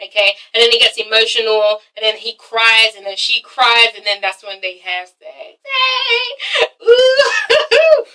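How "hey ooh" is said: high, gleeful squeal with laughter; excited whooping. Real, not acted. Vlogger reacting to the imagined love scene, gleeful whooping.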